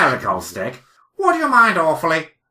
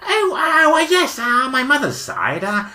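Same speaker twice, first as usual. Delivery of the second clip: a lightly voiced, higher and friendly passer-by; cheery cyclist; 9 semitones higher than usual. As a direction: greet them like a jolly stranger on the moor. S0 plays the cyclist Eric.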